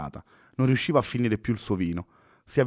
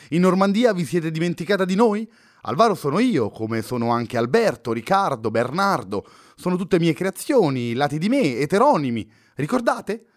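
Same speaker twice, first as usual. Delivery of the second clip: higher and louder, exasperated poet scolding her; irritated outburst; 7 semitones higher, 4 dB louder than usual. Acted. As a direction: push it up, fed up and indignant. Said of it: "esclamò irritato Fernando".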